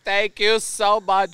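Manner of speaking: hammed-up tearful thank you; mock weepy thanks. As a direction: act out blubbering gratitude while wine drunk. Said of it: "And I just lost it".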